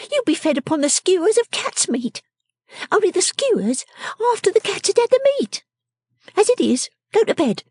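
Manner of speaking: very high, commanding character voice; bossy little scold. Acted. Miss Wren demanding the wretched man's money, high and bossy. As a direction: order him about in a high, sharp little voice.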